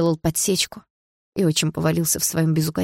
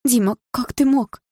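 high, drawn-out dismay; reproach; 7 semitones higher, 3 dB louder than usual. A narrator's reading. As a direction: reproach him in shocked disbelief. Mother reproaches Dima, upset. (потрясённый упрёк)

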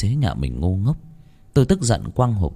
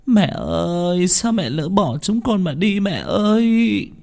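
high, very slow keening cry; wailing lament; 11 semitones higher, much slower than usual. A narrator's reading. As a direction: grieve over the coffin. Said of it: "Mẹ đang quỳ rạp ... khóc nức". (giọng khóc than thảm thiết, kéo dài)

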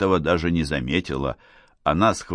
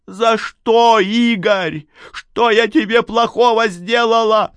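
high, loud, wounded outcry; anguished reproach; 6 dB louder, slower than usual. Acted. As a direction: cry out as one betrayed. Надежда в ссоре упрекает Гарика. (отчаянный упрёк, почти крик)

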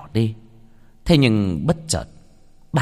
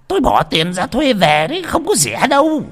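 high-pitched, loud complaint; indignant, voice pushed right up; agitated protest; 5 dB louder than usual. Acted. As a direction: protest shrilly about wasted money. Old man protests about money he paid.

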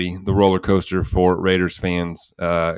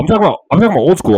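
louder, higher, rushed repeat; loud and fast; 8 semitones higher, 7 dB louder, much faster than usual. Real, not acted. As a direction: punch it up, almost shouting.

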